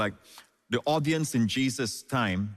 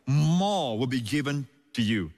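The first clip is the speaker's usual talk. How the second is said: long emphatic "more", then the rest falls away; stress on MORE. Shown as a demonstration. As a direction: hit "more" hard and hold it. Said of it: "highlight the word".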